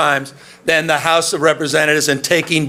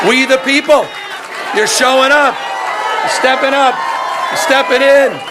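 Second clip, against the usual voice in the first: raised, rousing rally cry; rally speech; 7 semitones higher than usual. Acted. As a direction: fire up the crowd. Rally call to crowd.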